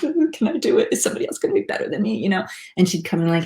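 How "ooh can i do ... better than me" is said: high, rushed anxious worrying; playfully panicky, racing through the doubts; mock stressed student. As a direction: imitate a student fretting, quick and hushed. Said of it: "we'd start getting real stressed".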